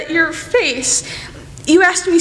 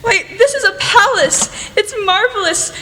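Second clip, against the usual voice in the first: high, laughing wonder; awed delight; 6 semitones higher than usual. Acted. Jo marvelling at Laurie's grand drawing room. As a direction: gasp at the riches around her.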